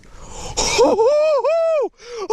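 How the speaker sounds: very high, crying-out joyful shriek; shrieking laugh. Real, not acted. Angler landing his biggest bass, shrieking with joy. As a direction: squeal with disbelief.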